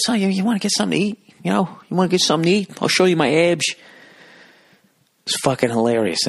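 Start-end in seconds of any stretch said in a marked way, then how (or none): 0.0-3.7 put-on high, fast Jersey guy hitting on a girl; imitating the Situation chatting up his date; Mike impression. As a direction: chirp it out fast and high, all swagger. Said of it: "looks at the girl he's with".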